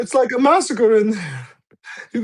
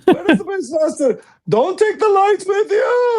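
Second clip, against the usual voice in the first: frantic shout; 10 semitones higher than usual. Acted. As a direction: yell after the soldiers leaving with the lights. Shouting after fleeing soldiers.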